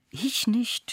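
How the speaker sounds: high, airy mock cry; thin high-pitched outcry; cried out. A narrator's reading. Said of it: "schrie ich".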